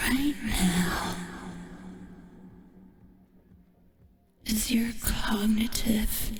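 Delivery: slow, gloating whisper. Acted. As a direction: drag out the verdict in a whisper. Slow whispered verdict on viewer.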